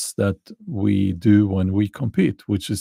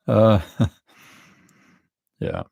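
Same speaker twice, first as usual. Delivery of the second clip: a real chuckle through the words; laughing. Real, not acted. To chat after hinting at the spy ship, laughing. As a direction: laugh it off after the sly hint. Laughing at her own sly hint.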